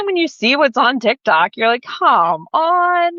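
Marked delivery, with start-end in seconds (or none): 1.8-3.1 high, drawn-out mock exasperation; exasperated. Creator complaining about TikTok moderation, mock exasperated. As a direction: protest it in a raised pitch.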